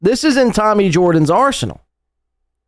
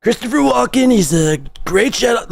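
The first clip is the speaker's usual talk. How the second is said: Trump impression; 6 semitones higher than usual. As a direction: imitate a bad Walken that sounds like Trump. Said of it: "you're doing Trump".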